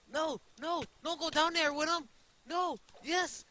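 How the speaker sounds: high, loud, urgent outbursts; high-pitched frantic gamer shouting; frantic game yelling. Real, not acted. Frantic calls during fight.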